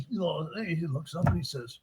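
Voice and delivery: ordinary talk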